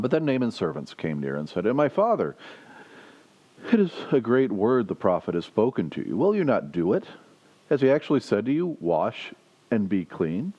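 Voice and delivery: reads the Bible passage aloud to the congregation; measured, lightly lifted reading voice; scripture reading. Acted. Preacher reading the passage aloud to the congregation.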